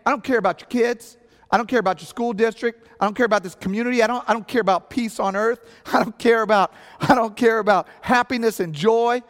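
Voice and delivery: hammed-up outrage; mock indignation. Mock-indignant reading of her meaning.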